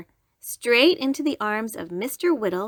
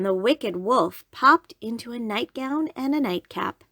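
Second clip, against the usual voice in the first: usual speaking voice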